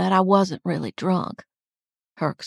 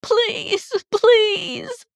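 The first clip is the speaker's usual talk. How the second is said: very high, pleading wail; high, frightened cries; terrified begging; 6 dB louder than usual. A narrator's reading. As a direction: plead desperately in a child's panic. Terrified child begging to be let go.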